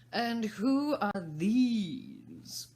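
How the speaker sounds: loud, slow haughty demand; imperious queen. Acted. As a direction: bark it down at the gardeners. Queen demands about gardeners.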